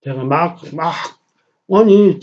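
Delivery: ordinary talk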